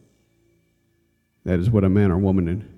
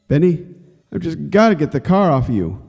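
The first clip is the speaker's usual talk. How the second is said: put-on higher, urgent boy's voice; desperate older brother; 8 semitones higher than usual. Billy tells trapped Benny he'll lift the car, higher desperate voice. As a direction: force it out in desperation. Brother in despair resolves to act.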